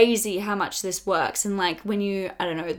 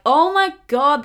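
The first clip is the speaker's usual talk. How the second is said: higher, louder mock horror; appalled; 6 semitones higher, 7 dB louder than usual. Speaker judging her past self, loud appalled outburst. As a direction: recoil loudly at the very idea.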